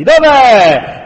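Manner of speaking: booming shout. A narrator's reading. Mob leader demands to know where she is, booming. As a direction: boom it from the back of a crowd. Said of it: "rimbombò una voce profonda".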